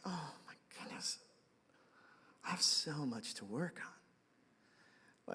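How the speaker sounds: breathless whisper, slow; whispered realization. Retold or imitated. Pastor, caught out, whispering in quiet shame. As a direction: hush it out to yourself, ashamed. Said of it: "I just kinda was like".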